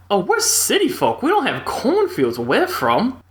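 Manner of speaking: high-pitched, drawn-out fake Southern accent. Best friend telling a stranger on a motorcycle, terribly fake Southern accent. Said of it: "don't do a terribly fake Southern accent".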